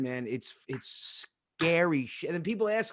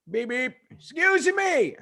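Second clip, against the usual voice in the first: high, slow mock hail; imitating father hailing someone; calling out; 9 semitones higher, 5 dB louder, slower than usual. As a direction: call from the car like an embarrassing dad. Father hailing girls from van.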